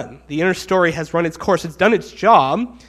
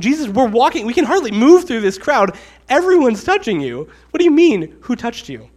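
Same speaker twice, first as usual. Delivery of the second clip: high, loud exasperated disbelief; incredulous protest; 6 semitones higher, 6 dB louder than usual. Acted. Disciples protesting to Jesus in the crowd, incredulous. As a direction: push it higher and louder, exasperated.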